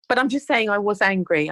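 ordinary talk

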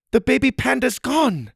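high, panicked cry; alarmed shout. A narrator's reading. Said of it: "the woman shouted".

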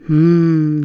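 mm of enjoying food; yummy mm; tasty hum. Shown as a demonstration. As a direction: hum as if something tastes good.

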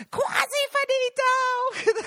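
very high, slow, drawn-out sing-song yell; Rosanna's scream. Retold or imitated. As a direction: stretch it into a piercing shrill call from the back of the room. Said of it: "That's how she sounds".

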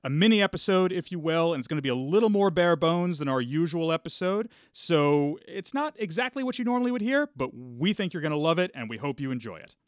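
ordinary delivery

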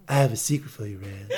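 low, intimate mock seduction with a laugh in it; Lanfear's teasing bedroom voice to Rand, half laughing. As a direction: purr it like a hammed-up seducer. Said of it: "you had a bedroom voice on".

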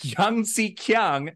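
slow, high "Yang Zi Kiang" with hard K; anglicised mispronunciation. Retold or imitated. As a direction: draw out "Yang Zi Kiang" as yang-see kee-ang. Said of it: "they're mispronouncing".

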